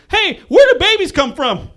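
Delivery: put-on high, loud, slow child's question; piping child voice, drawn out; little kid. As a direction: mimic a kid asking loudly. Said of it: "And he goes".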